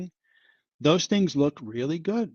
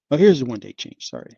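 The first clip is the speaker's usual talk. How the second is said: normal talking voice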